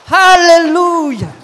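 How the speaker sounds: drawn-out shouted praise, high and slow; loud hallelujah. Real, not acted. Preacher shouting praise to the congregation, loud and drawn out. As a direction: call it out to the whole church.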